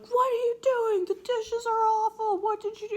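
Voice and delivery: mock parent yelling at a kid before friends. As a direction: blast it in front of the friends. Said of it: "Think if mom or dad yell at you".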